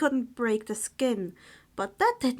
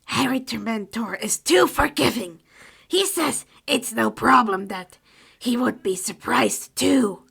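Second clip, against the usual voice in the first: louder elf voice, matter-of-fact; house elf voice; 7 dB louder than usual. Acted. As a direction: elf explaining for his master. Creature relaying Harry's words.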